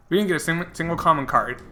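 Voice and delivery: ordinary delivery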